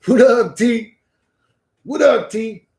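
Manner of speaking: loud, high call-out to a chatter; loud greeting. Real, not acted. Streamer calling out hello to a chatter, loud and high. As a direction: shout a greeting across the room.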